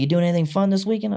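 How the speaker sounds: high, quick and chirpy; casual coworker question. Retold or imitated. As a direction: coworker asks lightly, mock cheerful. Coworker asks, joking culture.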